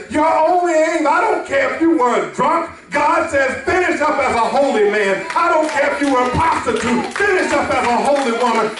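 high-pitched, forceful preaching; impassioned exhortation. Acted. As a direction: urge the congregation on with fervour.

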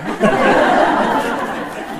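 a short, breathy laugh at the question; amused breathy chuckle. Real, not acted. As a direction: laugh it off lightly.

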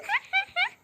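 high, exaggerated ha ha ha; mock laugh. Girl mocks her friend's boast with a high fake laugh. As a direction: cackle in fake triumph.

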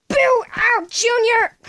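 high, loud call. Acted. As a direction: shout the name across the room. Calling out loudly.

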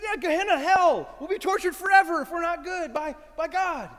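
high, loud, insistent voice; emphatic insistence. Pretend. Preacher in the street telling Jesus about hell, high and loud. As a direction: insist on it with full conviction.

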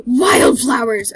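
loud, drawn-out cry of delight; big elated holler; excited shriek. Real, not acted. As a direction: shout it slowly, overjoyed at the find. Spots wildflowers in the game.

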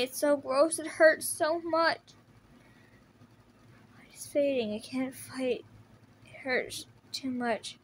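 ordinary talk

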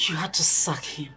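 whispered in disbelief; stunned whisper. Acted. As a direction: breathe the question out in shock. Daughter stunned by mother's decision.

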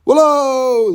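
loud high echo call; mountain call. Retold or imitated. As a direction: holler it like in the village hills. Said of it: "köyde dağların orada bağırırdım".

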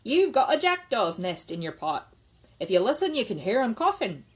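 loud, confident workman's verdict; knowing builder. A narrator's reading. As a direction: announce it like an old hand. Builder explains.

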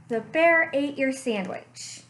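ordinary delivery, nothing marked

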